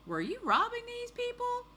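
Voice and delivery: very high-pitched incredulous question; questioning officer. Acted. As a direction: question the thief in an exaggerated high voice.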